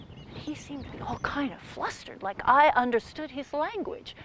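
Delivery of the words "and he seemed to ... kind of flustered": usual speaking voice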